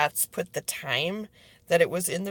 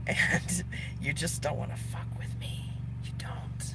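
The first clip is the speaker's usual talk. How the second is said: hushed, breathy threat; menacing whisper; 11 dB quieter than usual. Real, not acted. Closing the rant to liars, a hushed whispered threat. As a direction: whisper it like a secret warning.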